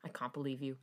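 low, soft dark scoff; dark disbelief. A narrator's reading. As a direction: drop it low and quiet in disbelief. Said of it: "darkly scoff in disbelief".

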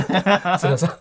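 ordinary delivery

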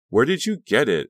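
bright eager chirp of a question; chirpy. A narrator's reading. Friend chirps eagerly about the skirt. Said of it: "Honey chirped".

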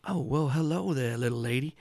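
a sweet-talking charmer greeting strangers; genteel stranger voice. Acted. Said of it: "and he goes".

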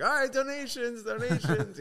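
high, loud call for donations; hawker's call. Retold or imitated. Street dancers stop to demand money from tourists, hawker's shout. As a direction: call out like a street performer working a crowd.

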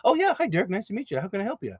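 mocking the guy switching to a cheerful high voice; voice jumps up. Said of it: "their voice just goes three levels up".

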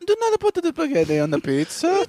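high mock Italian accent. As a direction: play the outraged Italian TikTok guy. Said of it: "Italian accent".

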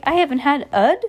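confused at the odd word; puzzled reading. Real, not acted. Reader puzzling aloud over a misprint in the book, confused.

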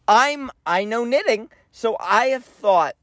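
usual speaking voice

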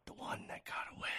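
unvoiced dramatic whisper; stage whisper. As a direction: whisper it like a secret. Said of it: "stage whisper".

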